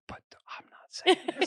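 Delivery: mock secret whisper. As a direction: hush it as an aside. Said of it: "And then I was like".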